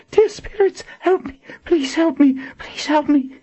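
high, frantic repeated plea; mumbled pleading. A narrator's reading. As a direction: pray for help over and over, pitch way up. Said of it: "she mumbled over and over".

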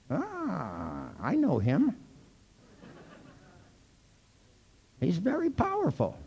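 high, hushed mock-mystic voice; a hammed-up soothsayer. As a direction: play the spooky seer. Mystical joke about diamond demon.

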